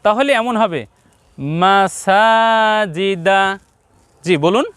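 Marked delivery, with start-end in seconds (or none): 1.6-3.4 মাসাজিদা run together with a long-held সা; slow, sustained মাসাজিদা, drawing out সা; chant-like level tone on মাসাজিদা, সা longest; syllables joined, stretched. Shown as a demonstration. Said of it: "আমরা একসঙ্গে যদি বলি".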